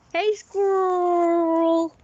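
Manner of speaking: loud, high, slow American "squirrel"; hammed-up American "Hey, squirrel!"; American accent. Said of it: "how Americans pronounce it".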